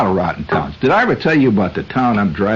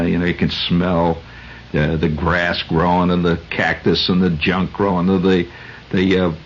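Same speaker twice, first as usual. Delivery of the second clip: ordinary talk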